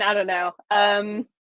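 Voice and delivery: normal talking voice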